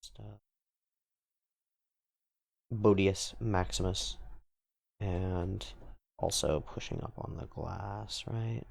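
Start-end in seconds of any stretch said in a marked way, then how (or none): none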